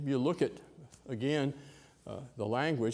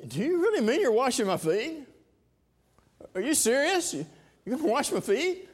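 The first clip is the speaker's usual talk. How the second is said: incredulous; 12 semitones higher, 6 dB louder than usual. Acted. Peter balks at foot washing.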